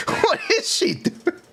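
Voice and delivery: breathy laugh through words. Real, not acted. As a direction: laugh while asking. Laughing at character design.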